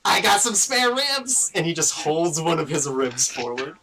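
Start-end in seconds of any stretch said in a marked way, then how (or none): 0.1-1.5 higher, lively voice setting up a pun; jokey skeleton. Acted. Skeleton offers Alton his ribs, jokey and lively. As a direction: offer the gag like a corny comic.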